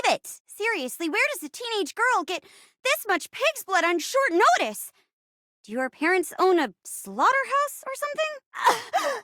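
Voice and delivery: high, indignant schoolgirl voice; nerdy teenage girl, aghast. Acted. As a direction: act appalled in a high teen whine.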